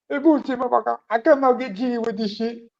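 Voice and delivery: put-on high, loud Ghanaian accent shout; Michael Blackson impression. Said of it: "Michael Blackson, you know, 'cause he's Ghanaian".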